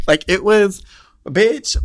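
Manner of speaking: loud, high-pitched burst of delight; excited gushing. Real, not acted. Storyteller reliving the sparks with friends, excited gushing. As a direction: burst out, too thrilled to finish. Gushing over the remembered encounter.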